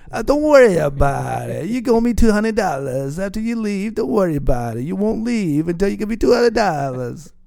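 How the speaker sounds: put-on high, loud Italian mobster accent. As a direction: play up a hammy Italian tough guy. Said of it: "my Italian accent I do sometimes".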